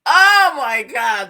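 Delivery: slow, loud drawn-out amused exclaim; stretched amused exclamation; amused oh my God. Real, not acted. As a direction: savor the memory. Recalling a funny moment.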